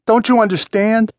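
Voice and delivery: high, pleading outcry; desperate cry. A narrator's reading. Wounded man begging Steve to understand, crying out. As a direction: beg frantically to be understood, pitch way up. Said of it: "he cried out".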